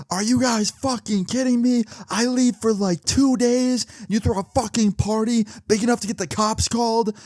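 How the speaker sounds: high-pitched outraged mock scream; indignant high-pitched telling-off; retold screaming mom. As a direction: rant like a furious mother, not full volume. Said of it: "she's screaming at Jessica".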